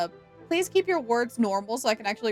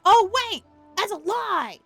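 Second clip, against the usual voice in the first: higher, louder burst of correction; sudden realization; 10 semitones higher, 7 dB louder than usual. Real, not acted. Streamer catches own mistake about the quest, sudden realization. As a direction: cut yourself off with a startled exclamation.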